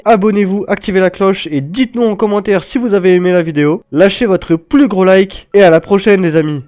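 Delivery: loud upbeat sign-off; youtuber outro. Acted. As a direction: hype the outro.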